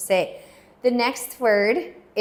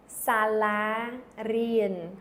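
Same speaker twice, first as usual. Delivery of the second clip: Sala rien, each syllable drawn out slowly; slow model word; much slower than usual. Shown as a demonstration. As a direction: say Sala rien slowly so students can follow. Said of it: "Say it with me".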